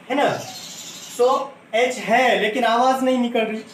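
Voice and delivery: ordinary talk, nothing marked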